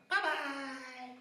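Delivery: tiny, very high, soft farewell; falling troll. Acted. Troll dropping off the bridge, faint high squeak. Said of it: "that troll went".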